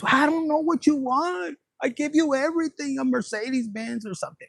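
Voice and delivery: a hint of a confused man pleading; clueless boyfriend. The boyfriend protesting he gives her everything, mildly baffled.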